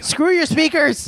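high, drawn-out playful yell; mock defiant yell. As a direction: yell it to blow the speakers, in jest. Defiant joke after louder request.

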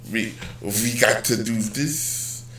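badly faked Ukrainian accent, soon given up; attempted Ukrainian accent. Host mimics old Ukrainian coworker, half-hearted Ukrainian accent. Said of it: "I can't even do his accent".

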